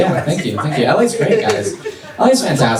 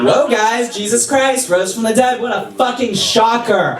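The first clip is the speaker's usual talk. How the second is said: pitched-up mock surprise, dripping sarcasm; sarcastic Jesus; 7 semitones higher, slower than usual. Jesus back from the dead, mocking the crowd sarcastically. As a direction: act shocked you rose, sarcastically. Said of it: "the most passive-aggressive, bitchy thing".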